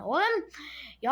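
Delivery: high, loud frustrated yell; outburst. Real, not acted. Angry gamer shouting at the screen. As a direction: blow up at the screen.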